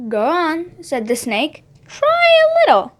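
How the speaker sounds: sly serpent voice, rising high on the last words; tempting snake. Acted. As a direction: coax her like a sneaky snake.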